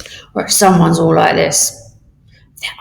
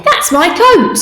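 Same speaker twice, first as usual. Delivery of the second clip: higher, louder, that's mine; indignant; 11 semitones higher, 5 dB louder, slower than usual. Shown as a demonstration. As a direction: protest that someone took your coat. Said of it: "Exclamation mark".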